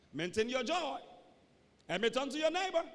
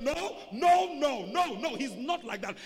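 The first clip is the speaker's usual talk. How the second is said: acted outcry of disagreement; emphatic protest; 3 semitones higher, 7 dB louder, slower than usual. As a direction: jump in loudly to defend a friend. Acts a friend defending him.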